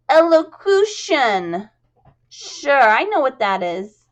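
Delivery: high, loud, slow squeaky exclamation; a touch of frustration in a squeak; squeaky chorus girl. Acted. Jasmine reacting to elocution lessons, squeaky. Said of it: "seemed a bit frustrated".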